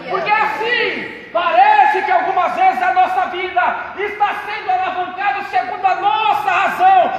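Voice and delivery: loud, ringing pulpit testimony with music; preaching at full force. Acted. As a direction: preach it out to the whole church. (pregação inflamada em voz alta)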